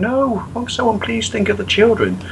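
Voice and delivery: worried parent. Retold or imitated. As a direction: clutch pearls, think of the children. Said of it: "worrying parent groups for just going".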